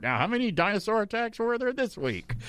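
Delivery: mock news report. Host joking about dinosaur attack news, mock anchor voice. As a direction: ask it like a TV anchor on the weekly count. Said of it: "They'll report it like a covid report".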